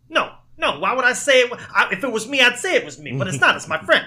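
high, loud protesting denial; defensive Vitti denial. Retold or imitated. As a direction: insist it's your friend, not you, voice up high.

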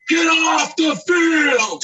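very high, loud, slow shouted order; furious yell. Retold or imitated. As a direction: yell it like he's lost his temper. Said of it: "He's yelling".

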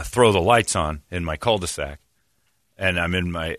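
usual speaking voice

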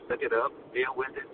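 normal talking voice

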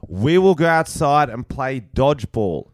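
put-on classroom voice, drawn out; teacher addressing class. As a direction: lay out the deal to a class, slowly and deliberately. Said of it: "give me a class and I'm gonna get the best lesson possible".

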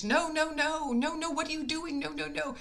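lightly voiced old woman, insisting no; elderly lady protesting. Ninety-year-old lady refusing payment.

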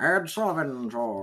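1950s radio announcer voice; old radio announcer. Shown as a demonstration. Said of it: "everybody talks like this".

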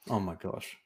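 ordinary delivery

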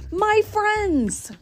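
high, drawn-out cry of relief; joyful cry. A narrator's reading. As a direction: cry out to long-lost friends. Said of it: "cried the elephant".

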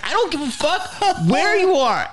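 very high-pitched retold fury; exasperated outburst. Comic retells scolding partner who skipped writing in Africa, exasperated. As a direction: explode at the lame excuse.

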